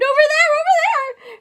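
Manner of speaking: high, loud panicked shout; screaming. Acted. Students pointing at the mouse, high panicked screams. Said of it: "people screamed".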